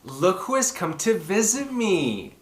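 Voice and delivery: ordinary delivery, nothing marked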